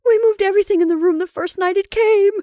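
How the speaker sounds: high, loud wail; terrified insistence. Acted. Rebecca answering sister about the room, terrified. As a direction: insist in fear.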